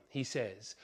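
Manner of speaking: ordinary talk, nothing marked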